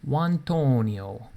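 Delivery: put-on slow, drawn-out Italian accent on the name. Streamer to chat, jokingly naming in an Italian accent. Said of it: "I said that in an Italian accent".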